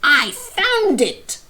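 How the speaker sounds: a parrot fighting over a flower, squawking loud. A narrator's reading. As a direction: squawk it while tugging the flower. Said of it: "squawked Parrot".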